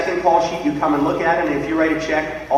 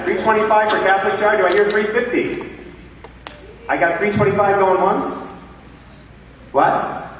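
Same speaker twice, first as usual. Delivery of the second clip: higher, lively auction patter; auctioneer calling bids; 7 semitones higher than usual. Acted. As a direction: run the room like a charity auctioneer.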